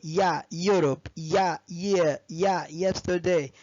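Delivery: يا stressed, then the word, slowly; slow model words. Shown as a demonstration. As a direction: stress يا before each word. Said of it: "كلهم بيبدأوا بيا".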